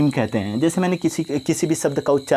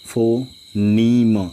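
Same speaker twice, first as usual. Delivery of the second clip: drawn out; much slower than usual. Shown as a demonstration. Teacher to students, phoneme drawn out very slowly. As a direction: stretch phoneme very slowly, as one long drawn word. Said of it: "जैसा कि मैंने आप लोगों से कहा था".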